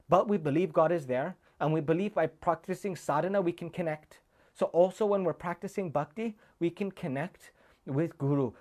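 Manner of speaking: rising devotional fervour; impassioned preaching. Acted. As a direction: preach it to move hearts.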